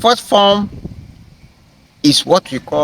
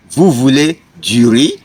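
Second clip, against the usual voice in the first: louder, with "riz" swept up as a question; rising question tone; 2 semitones higher, 5 dB louder than usual. Shown as a demonstration. Teacher giving an intonation example, rising question tone on "riz". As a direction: raise the tone on "riz" to ask. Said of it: "you can just raise your tone".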